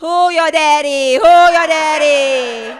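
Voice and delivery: high, loud, slow chant; triumphant taunt. Retold or imitated. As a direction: taunt him with a slow shout. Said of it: "shouted taunt".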